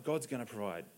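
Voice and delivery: low, hushed, settled assurance; calm trust. Retold or imitated. Abraham answering despite the doubts, quiet calm trust. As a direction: drop right down, quietly certain.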